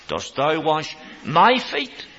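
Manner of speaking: slow "Dost thou wash my feet?" with the weight on thou; stress on thou. Shown as a demonstration. Peter to Jesus, slow and stunned. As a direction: draw it out, thou emphatic. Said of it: "very emphatic".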